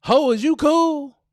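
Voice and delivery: high, loud, brash challenge. Shown as a demonstration. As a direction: bark it out like a sassy girlfriend.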